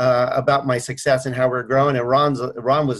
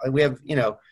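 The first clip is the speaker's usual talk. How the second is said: ordinary talk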